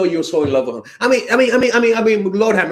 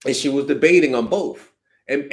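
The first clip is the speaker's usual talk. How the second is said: usual speaking voice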